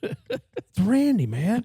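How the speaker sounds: high, slow copy of the caller's voice while laughing; lightly imitated Randy; imitating Randy voice. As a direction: echo the funny Randy line back. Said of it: "that voice is great".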